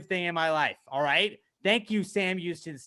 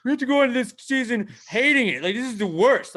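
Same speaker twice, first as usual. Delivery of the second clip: higher, louder mock whine; mocking complaint; 7 semitones higher, 6 dB louder than usual. Fan mocking the haters, in a complaining voice. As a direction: imitate a griping hater. Mimics negative fans' complaint.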